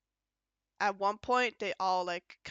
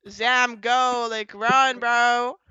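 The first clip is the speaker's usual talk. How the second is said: taunting, louder toward the end; teasing dare growing louder; mock rage baiting; 9 dB louder, slower than usual. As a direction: goad him, then shout the warning. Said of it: "kinda rage baiting Builderman".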